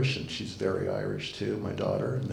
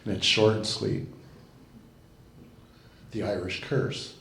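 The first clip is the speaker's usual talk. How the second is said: ordinary talk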